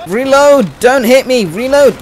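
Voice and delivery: loud urgent callouts; combat yell. Real, not acted.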